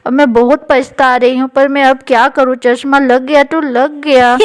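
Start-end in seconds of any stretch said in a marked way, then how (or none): none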